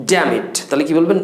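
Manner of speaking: ordinary delivery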